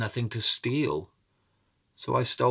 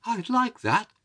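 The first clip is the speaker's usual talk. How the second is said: put-on old mother voice; 13 semitones higher than usual. A narrator's reading.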